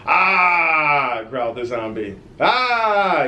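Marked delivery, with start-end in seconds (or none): 0.1-1.2 loud high zombie roar; zombie growl. Acted. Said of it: "worst zombie impression you guys will hear". 2.4-3.2 high, loud frightened yell; scared yell. Acted. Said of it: "yelled the bullies".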